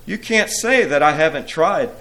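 a touch of sulky defensiveness; pouting. A narrator's reading. Anger defends failed efforts, mildly pouting. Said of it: "pouted Anger".

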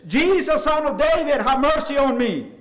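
high, loud cry for mercy; hollering. Retold or imitated. Blind beggar hollers to Jesus as he passes, hollering. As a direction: holler out a desperate plea at full voice. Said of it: "He started hollering".

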